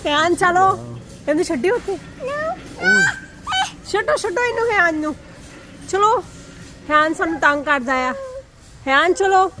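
high, bright-eyed coaxing sing-song. Real, not acted. Mother soothing toddler afraid of the cooker, high coaxing.

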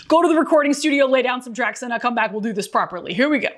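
ordinary delivery

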